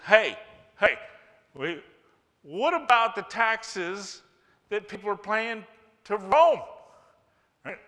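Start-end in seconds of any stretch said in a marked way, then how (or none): 0.0-6.6 loud challenge. Acted. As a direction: call out to shift the blame. Pharisees challenging Jesus.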